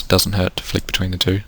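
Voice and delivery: ordinary talk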